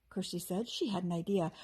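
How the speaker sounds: ordinary delivery, nothing marked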